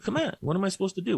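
high, quick dog voice; high-pitched dog voice, fast; voicing the dog. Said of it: "he's like".

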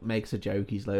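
normal talking voice

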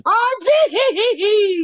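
high, quavering drawn-out scream; retold fan scream. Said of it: "Ernie screamed out".

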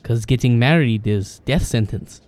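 put-on heavy accent with broken English; foreign accent. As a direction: play the blunt foreigner with a thick accent. GM voices Broden in broken English.

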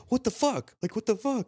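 high, stunned outcry; shocked what the fuck. Retold or imitated. Retelling being punched by his dad, high shocked outcry. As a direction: yelp it out in disbelief after a punch.

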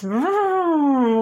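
imitated revving engine; playful mock engine rev; engine vroom.

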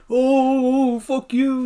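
high, mocking jeer. Spike taunting Icebox from the field, mocking. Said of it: "he starts mocking the ice box".

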